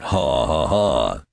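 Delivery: deliberate mock ha-ha-ha; acted laugh. A narrator's reading. Undergraduates laughing at Benjamin, in a mock laugh. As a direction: voice a crowd's jeering laugh lightly. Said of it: "The undergraduates laughed".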